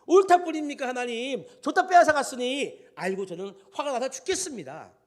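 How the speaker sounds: very loud, high outburst, easing at the end; acted outraged protest. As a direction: throw the complaint up at God, heated. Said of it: "우리의 심정을 막 대변하는".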